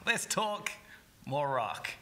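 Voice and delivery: loud brash American slogan; American accent. Retold or imitated. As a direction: belt it like an American rock ad. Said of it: "This only works in an American accent".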